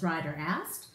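ordinary delivery

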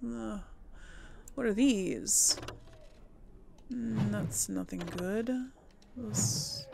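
usual speaking voice

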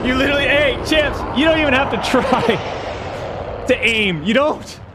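excited. Real, not acted. Mocking an easy enemy weapon.

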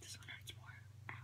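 hushed pained whisper. Real, not acted. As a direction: whisper it through the sting. Clothespins pinching her face.

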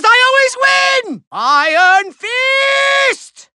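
high boast stretching into an attack call; triumphant battle cry. Acted. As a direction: crow it, then draw out the attack name. Villain boasts, calls his attack.

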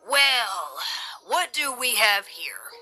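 slow, drawn-out mockery; smirking. A narrator's reading. Officer finding the pinned criminal, smirking. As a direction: draw it out smugly. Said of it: "he smirked".

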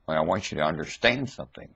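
ordinary talk, nothing marked

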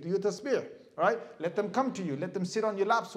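usual speaking voice

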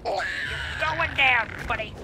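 slow, stretched mock threat; lazy slow teasing taunt; drawn-out taunt. Real, not acted. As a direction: draw every word out slowly.

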